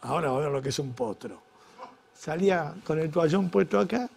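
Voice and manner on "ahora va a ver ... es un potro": low, soft inner boast, under the breath; muttered boast. Retold or imitated. Husband in the shower scene, low hushed gloating. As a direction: gloat to yourself quietly about the night ahead. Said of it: "vos decís".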